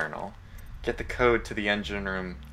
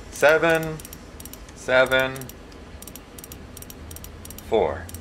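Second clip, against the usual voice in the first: "Seven", "seven", "four" with long pauses, higher then dropping; spaced-out digits; 4 semitones higher, slower than usual. Real, not acted. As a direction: punch in each digit slowly. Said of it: "entering code digit by digit".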